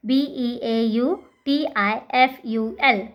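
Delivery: letters spelled one by one, slowly; slow spelling out. Shown as a demonstration. Teacher spelling a word slowly for students. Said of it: "किस तरह से करना है?".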